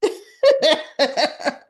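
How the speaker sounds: loud, high burst of laughter; hearty cackling laugh; real laugh. Real, not acted. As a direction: crack up at your own joke. Laughs at own added line.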